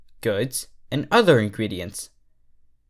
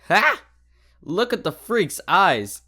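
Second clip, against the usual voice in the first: high jeering taunt; mocking colt; 6 semitones higher than usual. Young Pegasus mocking Derpy to his friends, jeering. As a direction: sneer at her like a school bully. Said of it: "laughing at what they saw".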